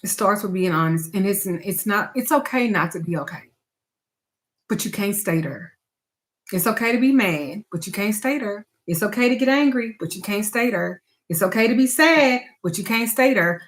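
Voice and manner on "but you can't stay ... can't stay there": ordinary talk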